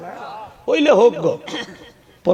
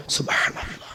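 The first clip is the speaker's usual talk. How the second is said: barely audible, voiceless murmur; mumbled rote dhikr; 4 semitones lower, 12 dB quieter than usual. Retold or imitated. Preacher mimicking hurried worshippers' dhikr, whispered rote. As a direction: mimic half-swallowed whispered praises. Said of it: "অনেকে সুবহান".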